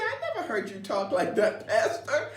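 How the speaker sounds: laughing teasing friend. Retold or imitated. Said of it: "She said".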